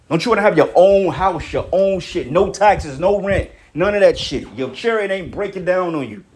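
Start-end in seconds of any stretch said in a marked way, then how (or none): none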